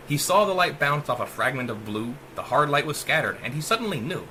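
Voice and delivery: usual speaking voice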